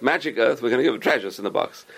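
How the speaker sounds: ordinary talk, nothing marked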